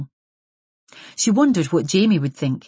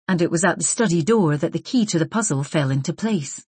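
ordinary delivery

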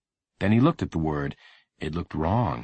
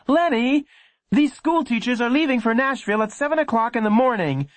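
raised, impatient mother voice; impatient mom; 4 dB louder than usual. Acted. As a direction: hurry him along. Mom calling.

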